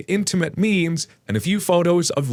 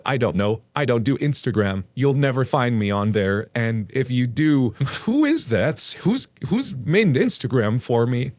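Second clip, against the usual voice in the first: ordinary talk, nothing marked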